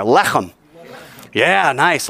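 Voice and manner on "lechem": Lechem with a throaty German ch; guttural ch. Shown as a demonstration. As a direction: say Lechem hawking up the ch. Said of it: "German sort of huh in there".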